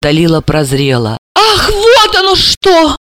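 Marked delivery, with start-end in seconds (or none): 1.4-3.0 high, slow cry; shout of realisation. Acted. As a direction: cry it out as it dawns on her. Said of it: "закричала она".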